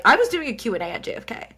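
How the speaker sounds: ordinary talk, nothing marked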